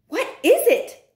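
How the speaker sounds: high, loud alarmed cry; witches crying out. Acted. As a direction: call out in alarm. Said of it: "cried the others".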